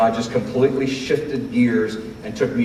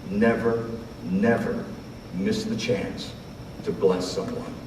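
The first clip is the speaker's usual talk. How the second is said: ordinary talk, nothing marked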